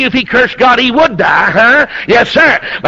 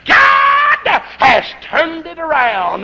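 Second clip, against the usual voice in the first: high, drawn-out pulpit shout; impassioned preaching cry; 7 semitones higher, much slower than usual. Real, not acted. Preacher proclaiming Job's turnaround to the congregation, impassioned. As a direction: stretch each word into a wailing proclamation. Climax of the sermon's point.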